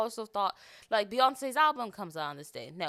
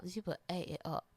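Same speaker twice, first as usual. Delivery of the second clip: softer, dropped-down echo; softer British-accented echo; quieter repeat; 3 semitones lower, 6 dB quieter than usual. Real, not acted. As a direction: let it fall away quietly. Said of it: "when I tell you".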